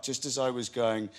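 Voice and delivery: ordinary talk, nothing marked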